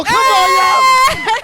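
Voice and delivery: high, slow, loud call; hype shout. Real, not acted. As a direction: stretch it out, hyped. Hyping up going on show.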